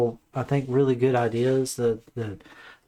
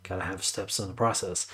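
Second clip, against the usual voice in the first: ordinary delivery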